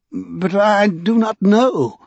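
high, slow, drawn-out helpless protest; falter, at a total loss. A narrator's reading. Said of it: "he began helplessly".

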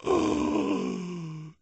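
high, sharp gasp of horror; shocked gasp. Acted. Fortune teller gasping at her smashed crystal ball. Lulu sees broken glass.

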